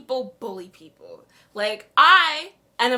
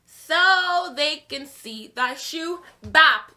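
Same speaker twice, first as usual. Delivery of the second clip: higher, louder, slowing to a defiant finish; sassy comeback; 4 semitones higher, 6 dB louder, slower than usual. Retold or imitated. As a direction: talk back with attitude, louder and louder. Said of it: "I was like".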